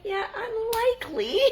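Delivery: high, slow deadpan "unlikely"; drawn-out sceptical yeah, unlikely; mock-doubtful answer to her daughter; dry reply. Her own dry reply retold.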